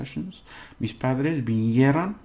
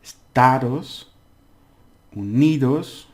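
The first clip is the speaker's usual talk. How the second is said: Estados Unidos with slow, loud stressed syllables; 2 semitones higher, 6 dB louder, slower than usual. Shown as a demonstration. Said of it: "thing we have to pr-, uh, stress".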